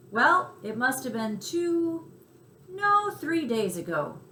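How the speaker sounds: a cricket thinking back, unhurried; lightly voiced cricket. Chester starts his tale to Tucker, lightly voiced and slow.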